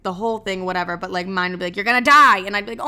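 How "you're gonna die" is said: loud, raised-pitch warning of doom; alarmed outburst. Retold or imitated. Intrusive thought warning her of doom, alarmed outburst. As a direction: blurt it out as a dire warning.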